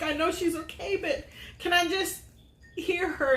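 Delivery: put-on a mild, teary echo of begging to hear her child; retold tearful plea. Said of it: "I just started crying".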